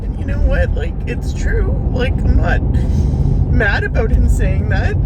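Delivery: breaking down in loud sobs while venting; crying. Real, not acted. Vlogger venting to viewers about her foot injury, crying loudly. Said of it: "I don't mean to cry".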